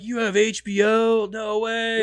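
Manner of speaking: high, slow awestruck gasp of disbelief; wide-eyed, can't believe it; amazed disbelief. Retold or imitated. Acted amazed friend quote.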